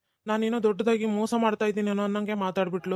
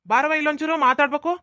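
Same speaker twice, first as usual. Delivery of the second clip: calling out; 6 semitones higher, 6 dB louder than usual. Acted. Son calling mother from afar.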